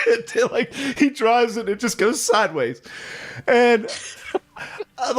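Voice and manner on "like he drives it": normal talking voice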